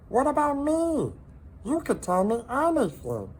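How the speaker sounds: high, loud little salamander; squeaky salamander voice. A narrator's reading. Salamander eagerly offering himself to Hector, high voice. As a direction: pipe it up like a tiny eager pet. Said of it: "said Minton".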